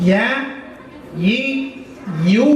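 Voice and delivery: "呀 一 游" said high, syllable by syllable; teacher demo of "呀 一 游"; drilled syllables. Shown as a demonstration. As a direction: spell out "呀", "一", "游" slowly.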